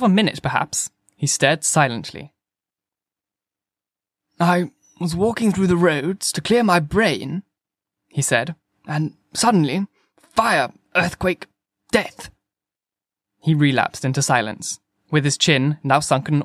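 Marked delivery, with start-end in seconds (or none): 4.4-7.4 higher-pitched, plaintive curate; distraught curate. A narrator's reading. Curate retells his morning to the narrator, plaintive. 8.9-12.3 words of disaster set apart by pauses; distraught curate. A narrator's reading. Curate recalls the sudden disaster, lightly voiced lament.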